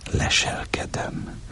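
a voyeur's hushed whisper. Acted. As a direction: breathe it almost silently. Narrator peeping, word repeated.

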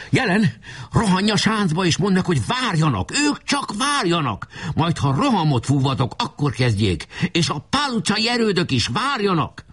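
high-pitched, excited orders; urgent commander. A narrator's reading. Commander sends adjutant to the forts, high urgent orders. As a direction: send your runner off with urgency.

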